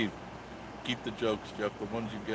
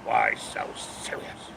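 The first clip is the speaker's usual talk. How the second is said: very low, slow whispered Joker line; much slower than usual. Retold or imitated. Quoting Batman to chat, low slow Joker whisper. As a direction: breathe it out like the Joker, drawn out. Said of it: "That's from, uh, Batman".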